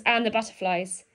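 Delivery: plain reading voice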